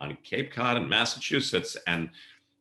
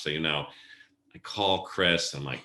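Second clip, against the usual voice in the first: ordinary delivery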